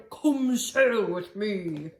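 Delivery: whale voice. Acted. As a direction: draw it out like a singing whale. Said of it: "It's my whale voice".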